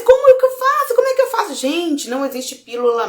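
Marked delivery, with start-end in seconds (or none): 0.0-1.5 mock exasperated plea. Retelling her own past doubt.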